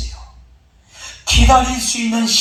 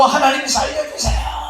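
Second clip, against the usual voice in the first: desperate prayer cry; 7 semitones higher than usual. Acted. Flood victim begs God to save him, crying out in prayer. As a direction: cry out for rescue, stretching the last syllable. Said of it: "막 그러니까".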